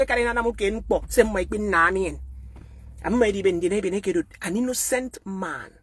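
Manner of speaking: normal talking voice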